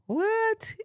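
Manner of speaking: soft, very high-pitched "what"; high, small, incredulous question; baffled. Real, not acted. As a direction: squeak it quietly in disbelief. Baffled by the message just read.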